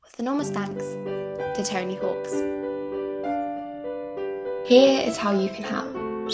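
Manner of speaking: usual speaking voice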